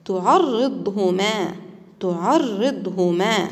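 تعرضهما drawn out slowly with an emphatic ض; slow demo pronunciation. Shown as a demonstration. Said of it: "سوف نقوم بقراءتها مرة أخرى". (نطق بطيء لتعرضهما مع تفخيم الضاد)

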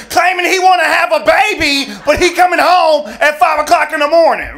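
high, loud mock complaint; ranting girlfriend. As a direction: shout the accusation like a fed-up girlfriend. Voicing angry Facebook update.

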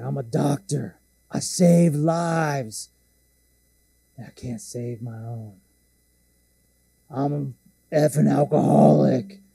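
slow, heavy lightly voiced drunk; a hint of a broken drunk doctor; slow drunk confession. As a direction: drag the words like a drunk confessing. Drunk stranger confesses in story.

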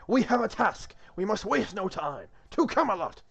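loud, high rallying call; rallying king. Acted. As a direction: rouse the knights to ride out. Arthur rallying his knights.

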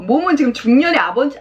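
normal talking voice

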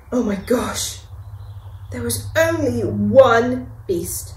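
ordinary delivery, nothing marked

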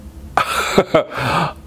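airy disbelieving laugh; breathless laugh. Real, not acted. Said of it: "uh, laughing".

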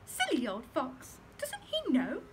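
high, smug little mouse voice. Acted. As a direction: chuckle at the silly fox in a high squeak. Mouse mocks fox.

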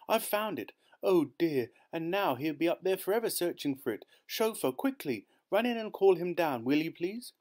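high, urgent exclaiming; fretful, hurried appeal; agitated cry. A narrator's reading. Wife sending chauffeur after her husband, agitated cry. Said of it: "she cried".

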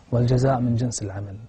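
normal talking voice

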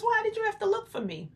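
put-on high-pitched questioning boy; imitating the boy. Acts out boy Jesus's puzzled question.

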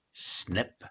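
ordinary delivery

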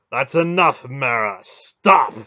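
commanding, emphatic interruption; forceful command. A narrator's reading. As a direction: cut the rage off, ending in a shout. Commands the raging god to stop.

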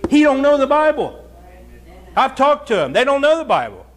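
loud, high emphatic declaration; forceful preaching. Real, not acted. Preacher rails at the congregation about seminary pastors, loud. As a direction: hammer the point home at the pulpit.

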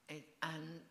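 ordinary talk, nothing marked

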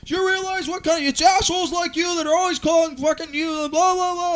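high-pitched mock rant; cop going off. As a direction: rattle on in a high, whiny-angry cop caricature. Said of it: "He keeps going off".

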